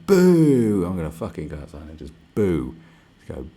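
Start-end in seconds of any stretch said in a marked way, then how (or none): 0.1-0.9 long, loud, higher boo. Shown as a demonstration. Showing people how to boo China, loud and long. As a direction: jeer it out at full volume. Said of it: "Like, going".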